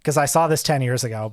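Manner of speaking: ordinary talk, nothing marked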